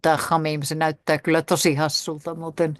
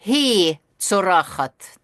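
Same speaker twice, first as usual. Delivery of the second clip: "Hi tsorachat" said slowly, each syllable drawn out; slow careful demo; 3 semitones higher, 4 dB louder, slower than usual. Shown as a demonstration. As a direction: sound out "Hi tsorachat" slowly for the learners. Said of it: "huutaa kirkua".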